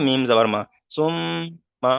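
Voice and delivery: সুম্মা with a long level m; ghunnah demo. Shown as a demonstration. Teacher finishing the mim drill, সুম্মা with the m held in a hum. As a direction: hum through the double ম of সুম্মা before releasing মা. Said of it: "একালি পরিমাণ গণনা করব".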